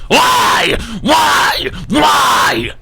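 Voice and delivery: shouting high and loud; anguished shout. Retold or imitated. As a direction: scream the why up to the sky. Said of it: "and I go".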